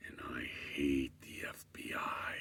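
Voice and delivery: low, hissed whisper. Retold or imitated. Guy staring at the narrator, low hostile whisper.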